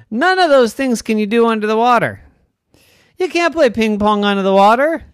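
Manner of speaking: playful rapid scolding; fast excited rant. Acted. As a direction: rattle it off like a smug kelp. Kelp's fast lecture.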